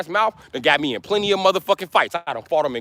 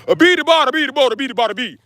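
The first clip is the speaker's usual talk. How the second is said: country accent mockery; 5 semitones higher, faster than usual. Imitating country talk babble.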